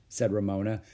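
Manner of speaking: ordinary delivery, nothing marked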